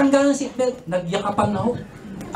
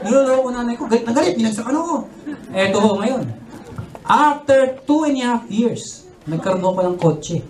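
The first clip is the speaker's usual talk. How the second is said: normal talking voice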